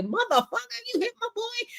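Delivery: high, strained mock yell from teammates; angry teammate. Teammates yelling at the player, high mock fury. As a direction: pitch it up like a furious player.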